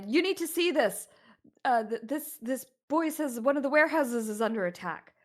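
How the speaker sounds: urgent report to the guard; groom reporting. Acted.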